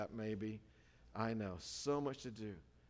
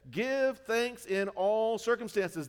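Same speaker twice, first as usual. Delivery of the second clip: loud, very slow, every word weighted; proclaimed; 9 semitones higher, 9 dB louder, much slower than usual. Acted. Pastor reading Betsy's verse, slow proclamation. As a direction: declare it like a verdict.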